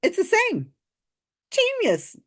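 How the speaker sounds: very high, squeaky mock praise; sarcastic awe. YouTuber mocks psychic's vowel logic, high sarcastic awe. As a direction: squeal it as if dazzled.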